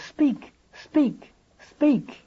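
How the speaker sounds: high-pitched repeated command, each word falling; imploring command. Acted. Ahab says it to the dead whale's head, imploring command. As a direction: call it out high, then let it drop.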